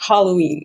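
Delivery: Halloween with a strongly breathed H; "Halloween" with an audible English H; heavily aspirated H. Real, not acted. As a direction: lean hard on the H opening "Halloween". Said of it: "On sent le H aspiré à mort".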